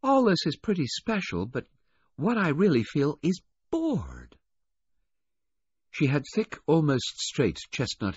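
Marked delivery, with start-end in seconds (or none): 0.0-4.4 put-on high girl's voice, slowing down on the boredom; sulky, bored rich girl, pitched high; disgruntled. A narrator's reading. Rich girl confessing boredom to the waiter, disgruntled. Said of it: "she said in a disgruntled voice".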